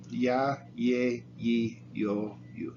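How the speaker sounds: "Ya, ye, yi" starting with an English y sound. Shown as a demonstration. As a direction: doble L spoken as a plain y. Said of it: "pronounced like a Y".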